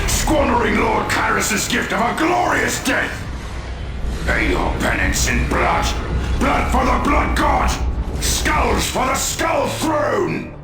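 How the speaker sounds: roared battle chant; high, straining berserker shout; fanatical war cry. Acted. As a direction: bellow it like a bloodthirsty zealot. Fanatic champion roars battle chant.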